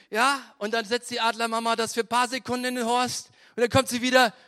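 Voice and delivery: building to a yell. Acted. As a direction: whip them up to the scream. Retelling eagle's drop, building.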